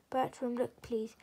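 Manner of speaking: plain reading voice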